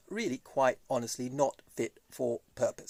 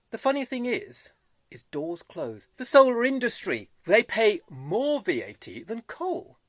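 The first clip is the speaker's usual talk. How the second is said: usual speaking voice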